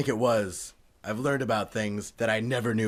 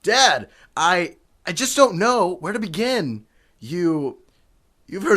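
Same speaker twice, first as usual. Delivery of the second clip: agitated confession; 7 semitones higher, 5 dB louder than usual. Acted. Son struggles to confess secret.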